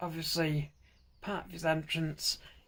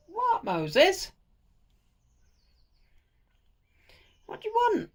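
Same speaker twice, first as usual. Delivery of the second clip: talking to a cat; 6 dB louder than usual. Real, not acted. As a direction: coo at the cat in a high voice.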